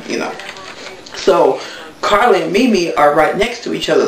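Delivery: ordinary delivery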